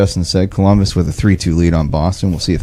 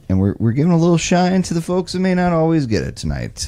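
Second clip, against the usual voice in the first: announcer voice; 10 semitones higher than usual. Acted. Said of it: "Doing my Jeff announcer voice right now".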